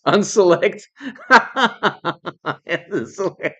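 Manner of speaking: giggling through the word; can't stop laughing at the word unselect. Real, not acted. Laughing at the phrase.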